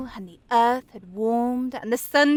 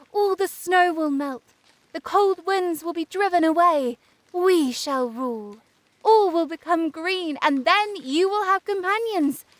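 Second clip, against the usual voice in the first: high, delighted exclamations of admiration; rapturous praise; 6 semitones higher than usual. A narrator's reading. Sunbeams promise the first flower summer companions, joyful and admiring. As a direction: proclaim it joyfully, pitched up.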